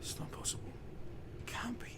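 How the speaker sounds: soft, breathy storytelling whisper; hushed narration. A narrator's reading. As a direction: draw the listener in quietly.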